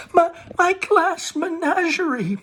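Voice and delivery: very high, slow anguished cry; Laura wailing over broken glass; cry out wounded. Acted. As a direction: cry as if struck. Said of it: "Laura cries out as if wounded".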